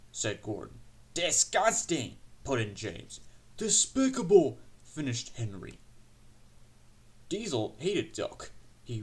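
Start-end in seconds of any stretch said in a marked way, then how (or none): 1.1-2.2 slow, high-pitched disgust; outraged engine. A narrator's reading. James adds his outraged condemnation. 3.6-4.6 high, loud indignant cry; outraged engine. A narrator's reading. Henry finishes the outraged condemnation.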